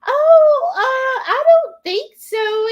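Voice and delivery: high squeaky mock-mom voice, a little evasive; imitating mom. Mom's evasive answer, retold.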